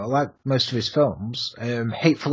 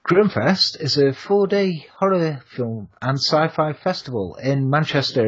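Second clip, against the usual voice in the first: ordinary talk, nothing marked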